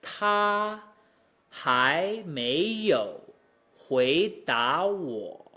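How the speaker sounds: exaggerated tones on every syllable; drawn-out teacher's model; model Mandarin tones. Shown as a demonstration. Teacher models the example sentence.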